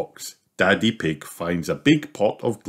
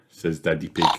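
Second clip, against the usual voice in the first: ordinary delivery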